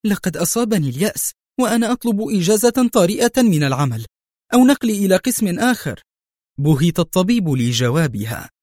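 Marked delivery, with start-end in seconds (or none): 0.1-6.0 high, fast, agitated complaint; edgy, irritated. A narrator's reading. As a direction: blurt it out at the end of your patience. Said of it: "وقالت في عصبية".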